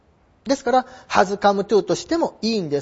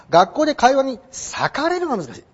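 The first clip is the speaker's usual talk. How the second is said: normal talking voice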